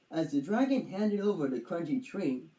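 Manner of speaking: ordinary delivery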